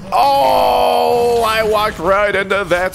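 sudden high, loud cry; pained cry. Real, not acted. Loud pained cry after walking into a trap. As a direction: cry out as it goes wrong.

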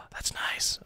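awed little whisper. Acted. Gamer whispers in awe at a new tech. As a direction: breathe it out in awe.

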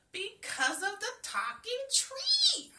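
high, loud, slow excited yell. A narrator's reading. Girl yells to mama about the tree, high and loud. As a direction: shout the answer bursting with joy. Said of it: "I yelled".

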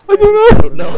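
high, loud laughing reply; real giggles breaking into the words. Real, not acted. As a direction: squeak it out through real laughter. Cracking up at the confusion.

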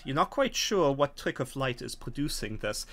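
ordinary delivery

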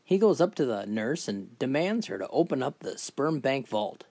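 ordinary talk

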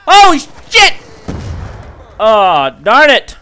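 high, loud shocked cry; startled shout. Real, not acted. Sudden enemy ambush.

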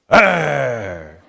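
mock monster roars; toddler playing monster. Dad imitating his son bouncing a Barbie, monster roar. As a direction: roar like a little boy smashing a doll around. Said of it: "like it's some sort of monster".

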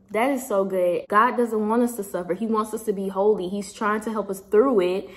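ordinary talk, nothing marked

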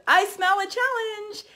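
high, slow, eager catchphrase voice; imitating Ashley. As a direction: draw it out up high, relishing it. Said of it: "first thing out of Ashley's mouth was".

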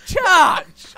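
loud, high protest through laughter; laughing shout. Real, not acted. Player to friend who blocks them, laughing shout. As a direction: yell at a friend, half laughing.

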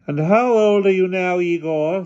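put-on slow, loud, imperious old lady. A narrator's reading. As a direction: speak like a commanding elderly aunt.

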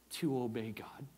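whispered sermon point; hushed whisper. Real, not acted. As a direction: drop to a whisper.

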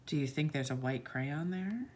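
usual speaking voice

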